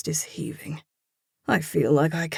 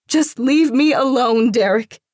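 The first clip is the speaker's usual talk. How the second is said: higher, louder hurt woman; upset woman's voice; 9 semitones higher, 9 dB louder than usual. A narrator's reading. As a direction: push him away, voice raised in hurt. Frightened woman backs away.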